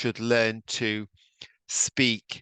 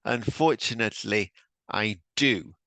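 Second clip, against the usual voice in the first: Unfortunately stretched out with plenty of emphasis; emphatic. Shown as a demonstration. Teacher modelling the drill answer for students, emphatic. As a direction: lean into Unfortunately, then a firm I do. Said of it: "say with plenty of s-, emphasis".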